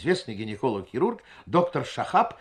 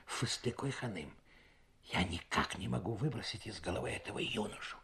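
very soft, hushed confidences to a friend; hushed confiding; 3 semitones lower, 11 dB quieter than usual. A narrator's reading. Wife whispers to her friend about the young man, hushed. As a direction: keep it low, a secret between women.

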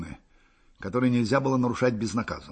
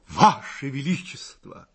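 solemn address; slower than usual. A narrator's reading. Hussar solemnly pledges to emperor.

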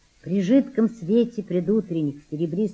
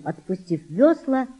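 plain reading voice

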